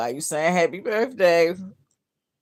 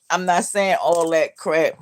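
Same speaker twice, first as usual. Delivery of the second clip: usual speaking voice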